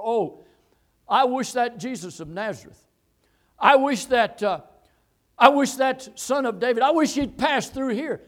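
loud, high preacher's wishing; fervent longing. Acted. Preacher voicing the blind man's wish for Jesus, fervent. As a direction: cry out the wish with force.

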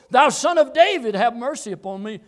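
loud, high cry, easing at the end. Acted. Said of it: "he cried so much the more".

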